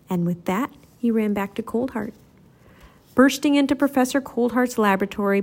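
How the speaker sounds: ordinary delivery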